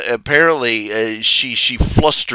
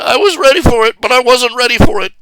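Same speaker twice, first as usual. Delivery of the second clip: much higher, louder, half-crying fluster; flustered laughing protest; 12 semitones higher, 6 dB louder, faster than usual. Retold or imitated. Ashley flustered after the werewolf call, high crying protest. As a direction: blurt it out, caught off guard. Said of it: "And she's like".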